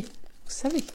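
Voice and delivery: unvoiced conspiratorial whisper; secretive whispered vous savez; whispered aside. Shown as a demonstration. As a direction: lean in and whisper it. Teasing aside repeated.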